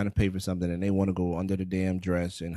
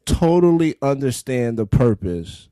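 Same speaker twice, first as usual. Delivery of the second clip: laughing retelling; 2 semitones higher, 8 dB louder than usual. Retold or imitated. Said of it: "I said".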